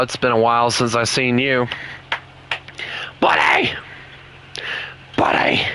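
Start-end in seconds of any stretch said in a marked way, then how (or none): 3.2-3.7 whispered call. Real, not acted. Calling softly to dog.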